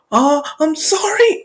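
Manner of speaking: put-on high, small-child apology; panicked apology. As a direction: blurt it high and frightened. Child apologises after stabbing.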